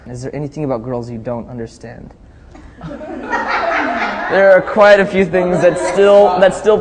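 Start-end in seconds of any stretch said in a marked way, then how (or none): 2.8-6.8 laughing. Real, not acted. Laughing at the girls question.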